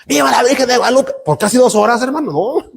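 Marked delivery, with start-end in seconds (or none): 0.1-1.1 mock crowd cheer. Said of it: "empiece a gritar".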